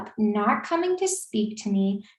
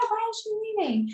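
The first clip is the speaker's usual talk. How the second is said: high, fast mocking whine; mock guys' question; 12 semitones higher, 5 dB quieter, faster than usual. Frat guys baffled she is leaving, high mocking whine. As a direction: mimic their baffled whine.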